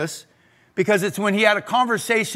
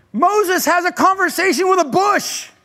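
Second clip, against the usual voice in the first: high and loud, full of wonder; amazed; 10 semitones higher, 4 dB louder than usual. Real, not acted. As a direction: marvel at the absurdity. Marvels at the story.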